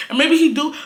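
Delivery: usual speaking voice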